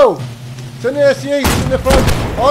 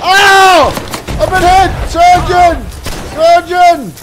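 higher, loud hurt yells; pained shouting; 4 semitones higher, 4 dB louder, slower than usual. Pretend. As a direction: cry out for the surgeon. Hit in game, calls surgeon.

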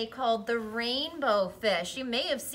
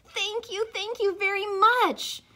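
excited thanks; 8 semitones higher, 7 dB louder than usual. Acted. Little fish gets a scale.

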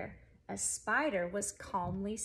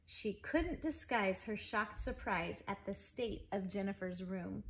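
usual speaking voice

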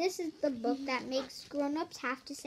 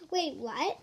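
usual speaking voice

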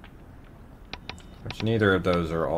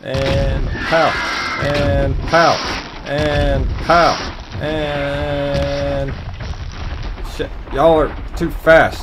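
frantic excitement; 5 semitones higher, 4 dB louder, much slower than usual. Real, not acted. Frantic shooting.

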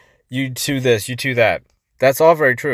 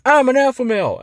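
high, louder boastful proclamation; mock screaming; 11 semitones higher, 4 dB louder than usual. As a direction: imitate a loudmouth screaming his boast. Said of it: "those people who are screaming".

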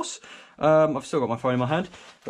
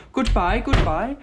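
sing-song goodbye; 5 semitones higher, slower than usual. Pretend. Waving bye to the room.

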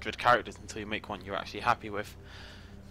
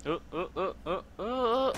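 rising alarm; 8 semitones higher than usual. Real, not acted. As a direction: build the panic up. Watching an execution.

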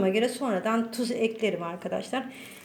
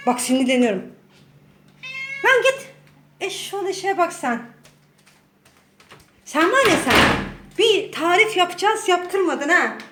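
loud, high angry outburst; yelling at the cat; 6 semitones higher, 4 dB louder than usual. Real, not acted. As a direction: let the cat have it, real annoyance. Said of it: "Sadece bağırıyorum yani". (kedisine sinirlenip bağırıyor)

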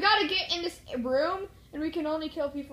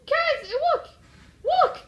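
Look! pitched much higher and louder; excited look; 11 semitones higher, 7 dB louder, much slower than usual. Real, not acted. Showing his teammate the enemies, excitedly calling out. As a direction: call out eagerly to your friend.

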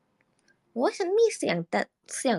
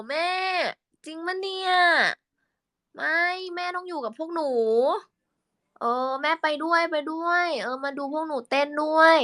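much higher pleading daughter voice; whiny kid to mom; 9 semitones higher than usual. Shown as a demonstration. Pleading with mom to come watch them dance, much higher. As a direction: beg mom up high like a little kid. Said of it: "คุยกับพี่สายพี่กวางเหรอ".

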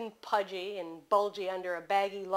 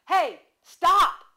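loud, higher yell building to stop; 8 semitones higher, 11 dB louder than usual. A narrator's reading. Man at the window yelling at the car driving off, loud high yell. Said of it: "he yelled".

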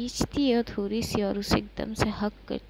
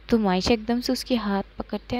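usual speaking voice

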